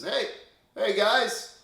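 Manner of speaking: loud, high friendly hail across the water; calling out. Retold or imitated. Jesus calling loudly to fishermen on a boat. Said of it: "Jesus calls out to them".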